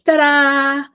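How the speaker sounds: high, bright-eyed little mouse announcing itself; squeaky triumphant mouse reveal; cute mouse ta-da. Pretend. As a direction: burst out like a tiny mouse on stage. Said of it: "throws open the doors like".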